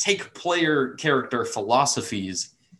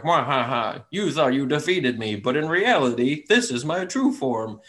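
cartoon villain; 3 semitones higher than usual. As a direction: ham it up like a Saturday cartoon baddie. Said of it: "Saturday morning cartoon villainous".

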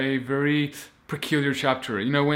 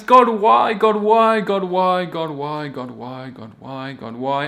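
repeated cry of why; 2 semitones higher, 7 dB louder than usual. Retold or imitated. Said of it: "They're like".

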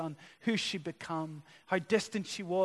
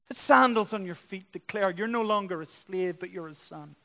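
raised, emphatic preacher's appeal; fervent preaching; 3 semitones higher, 5 dB louder than usual. Real, not acted. Preacher offering the Father's love to the congregation, fervent preaching. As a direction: press each promise home to the congregation.

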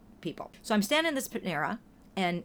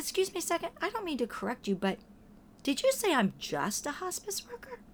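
gently polite challenge; 9 semitones higher than usual. Retold or imitated. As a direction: ask kindly while still correcting her. Polite correction of the stranger.

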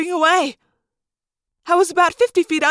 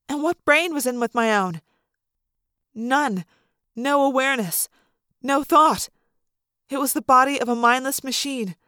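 plain reading voice

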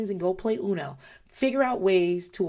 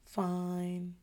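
sulky fine; 10 dB quieter than usual. Retold or imitated. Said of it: "Like that".

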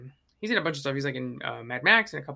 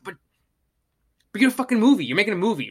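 mock exasperated outburst; 4 semitones higher, 7 dB louder, much faster than usual. Ranting at lazy filmmakers.

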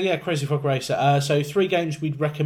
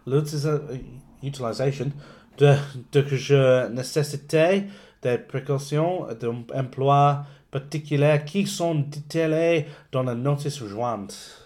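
English speaker reading French aloud, slow; foreign accent in French; 2 semitones higher, 6 dB louder, slower than usual. Shown as a demonstration. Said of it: "apologise for my poor French accent".

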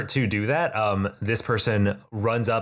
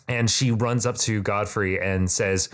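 ordinary talk, nothing marked

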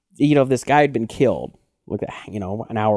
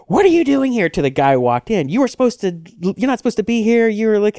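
landlady; 9 semitones higher, 3 dB louder than usual. Retold or imitated. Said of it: "She's like".

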